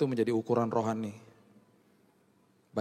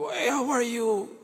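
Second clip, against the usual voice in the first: much higher, slow, gushing hello; excited greeting; 12 semitones higher, much slower than usual. Retold or imitated. Parents greeting their son stepping off the camp bus, high and gushing. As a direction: greet him like a long-missed child.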